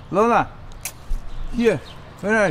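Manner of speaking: high, loud calls; calling a dog. Real, not acted. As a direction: call the dog from afar.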